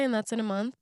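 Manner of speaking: ordinary talk, nothing marked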